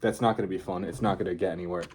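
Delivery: ordinary delivery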